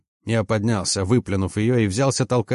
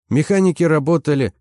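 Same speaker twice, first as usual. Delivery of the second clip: usual speaking voice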